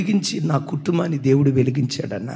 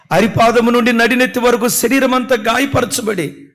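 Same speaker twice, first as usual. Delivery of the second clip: higher, louder fervent preaching; full-force preaching; 8 semitones higher, 8 dB louder than usual. Acted. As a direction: pour it out to the congregation with mounting force. (ఉద్రేకంతో బిగ్గరగా ప్రసంగం)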